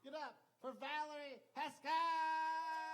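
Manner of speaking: big, high shout with drawn-out ending; announcer shout. Real, not acted. Host bringing up the next comic, shouting. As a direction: belt out the intro, stretching the name.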